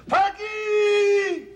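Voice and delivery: high, piercing yell of a name out the window; mother's window yell. Retold or imitated. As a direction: bellow the kid's name like the mother. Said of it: "she would open up the window and she would yell".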